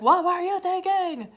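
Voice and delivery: put-on loud, high scolding at kids; scolding shout. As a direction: scold them like an angry parent. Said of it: "shake those kids and be like".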